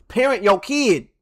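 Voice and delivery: slow, loud scolding; stern scold. Real, not acted. Streamer scolding the mother on the train, slow and loud. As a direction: tell that mother off, slowly and firmly.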